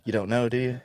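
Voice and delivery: lightly voiced teasing robot; mocking robot. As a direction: needle him, a hint of a smug machine. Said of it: "he kept mocking me".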